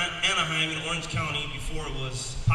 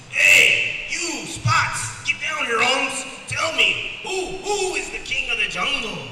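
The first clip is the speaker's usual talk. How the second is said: cocky lion; 9 semitones higher, 7 dB louder, slower than usual. Acted. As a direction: swagger like a street-tough lion, chest out. Lion bullies animals in story.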